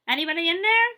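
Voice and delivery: loud high call into an empty room. Acted. Teacher calling into bathroom.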